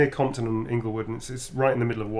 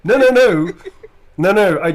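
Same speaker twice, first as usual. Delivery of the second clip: protesting Brit; 7 semitones higher, 10 dB louder than usual. Acted. Acts out denying being a narc.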